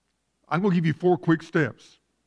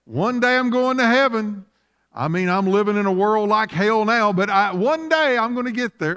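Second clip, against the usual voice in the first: higher-pitched mock voice of a saved churchgoer; imitating complacent believer; 5 semitones higher than usual. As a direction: put on a high voice for the someday-heaven crowd. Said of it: "some wanna just say".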